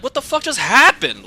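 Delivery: loud, high-pitched bafflement; retold disbelief. Boyfriend hears screaming downstairs, loud retold bafflement. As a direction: act out being completely thrown.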